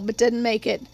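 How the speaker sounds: ordinary delivery, nothing marked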